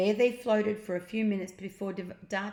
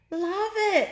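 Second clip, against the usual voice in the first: very high, louder thrilled shout; excited girl; 13 semitones higher, 7 dB louder than usual. Acted. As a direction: squeal on the wheelie. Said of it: "exclaimed Jemima".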